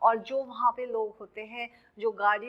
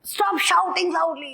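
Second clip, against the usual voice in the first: quoted shout; 7 semitones higher, 6 dB louder, much slower than usual. Retold or imitated. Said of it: "कहते हैं ना".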